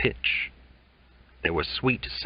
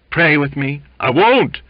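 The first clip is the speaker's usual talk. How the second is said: high, loud exchange; shouted quarrel; 7 semitones higher, 9 dB louder than usual. A narrator's reading. Cook and Waite quarrel, loud shouts. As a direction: fling it back hard.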